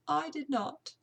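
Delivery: amateur British servant voice; British housekeeper. Acted. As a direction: voice a servant speaking up about her household.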